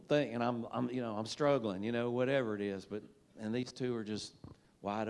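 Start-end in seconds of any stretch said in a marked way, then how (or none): none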